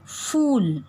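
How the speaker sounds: "फूल" का ऊ खींचकर ऊंचा बोलें; "फूल" में लंबा, ऊंचा ऊ दिखाएं; long oo demo. Shown as a demonstration. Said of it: "तो ऊ का स्वर ऊंचा होता है". (बड़ी ऊ का लंबा उच्चारण)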